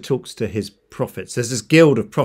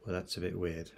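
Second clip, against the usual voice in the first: very soft, lower puzzled musing; thinking to himself; 4 semitones lower, 17 dB quieter than usual. Acted. Servant puzzling over the prophecy, thinking to himself. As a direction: say it under your breath, as a private thought.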